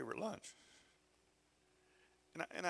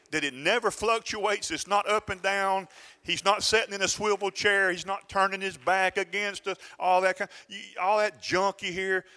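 raised, loud pulpit challenge building in force; impassioned preaching; 8 semitones higher, 8 dB louder than usual. Real, not acted. Preacher challenging doubts about God's steady love, impassioned preaching. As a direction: challenge the congregation, high and loud, then a firm final word.

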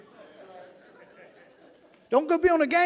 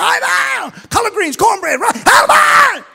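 hammed-up shout of Amen, much higher and louder; mock shouting amen; 12 semitones higher, 12 dB louder, slower than usual. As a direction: yell it like a worked-up churchgoer. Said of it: "they be yelling at a grocery list".

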